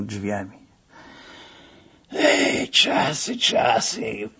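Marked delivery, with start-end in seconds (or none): 2.1-4.3 sigh. Acted. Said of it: "westchnął pan Siasiu".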